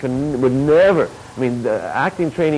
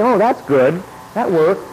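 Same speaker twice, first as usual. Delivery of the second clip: pleased praise; 7 semitones higher, slower than usual. Retold or imitated. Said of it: "I say".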